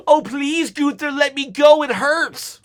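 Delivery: mock pleading. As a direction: beg Gunther like a hammed-up victim. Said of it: "he could say".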